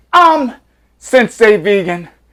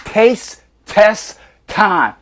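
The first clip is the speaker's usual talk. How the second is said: slow drawn-out catchphrase; 3 semitones higher, much slower than usual. Acted. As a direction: stretch every word, very slow.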